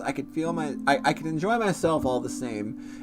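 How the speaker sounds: story narration. Acted. Narrating as character inner voice.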